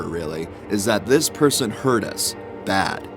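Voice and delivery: usual speaking voice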